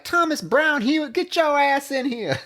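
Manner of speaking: high, yelling mother's call; scolding mom. Retold or imitated. Mother calling her son into the room, high scolding holler. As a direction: holler the full name like an angry mom. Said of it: "she calls to him".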